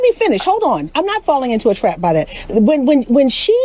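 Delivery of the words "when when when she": usual speaking voice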